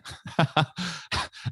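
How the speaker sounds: high laugh. Real, not acted. Laughs about host's doubt.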